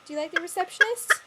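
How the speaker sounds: loud, high coaxing question; loud sweet-talk to hen. Real, not acted. Asking the hen, then repeating softer.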